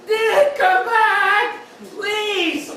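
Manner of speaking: high, long drawn-out mock wail; desperate calling out. Tied-up man to departing date, drawn-out desperate mock cry. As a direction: call after someone, hammed-up desperation.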